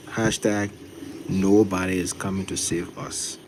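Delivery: drawn-out slogan; slow, deliberate. Shown as a demonstration. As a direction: say each word slowly. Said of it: "you gotta say it slow".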